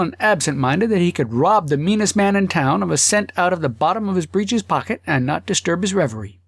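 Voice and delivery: plain reading voice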